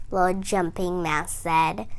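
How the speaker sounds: usual speaking voice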